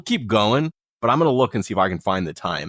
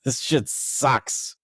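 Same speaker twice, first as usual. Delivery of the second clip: high, exasperated swear, then a slow drag; frustrated curse; 4 semitones higher, much slower than usual. Real, not acted. Benched player scored big, exasperated high curse then slow grumble. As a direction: let the annoyance spill out, dragging the complaint. Fantasy football loss frustration.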